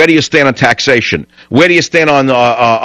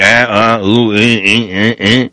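halting "uh, eh" hesitation sounds mocking a stumbling host; mock stammering; 2 semitones lower, slower than usual. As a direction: stutter out empty fillers in mockery. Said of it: "without going".

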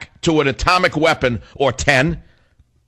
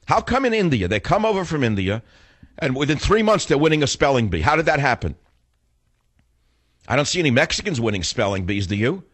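ordinary talk, nothing marked

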